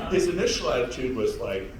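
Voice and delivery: ordinary delivery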